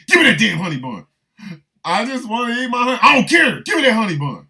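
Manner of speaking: hammed-up jailhouse squabble; mock prison shouting match. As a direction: yell it like inmates fighting over a snack. Acted prison quarrel over honey bun.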